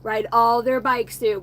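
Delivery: ordinary delivery, nothing marked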